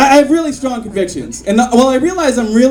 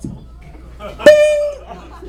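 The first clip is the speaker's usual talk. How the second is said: high, ringing "Bing!" like a lightbulb going on; ding the idea into your head; cartoon bing. Lightbulb moment sound effect.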